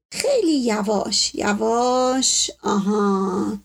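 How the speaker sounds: long drawn-out words of caution; slow, careful coaxing. Acted. Doctor guides the turning of his patient, slow and careful. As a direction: ease them along very slowly.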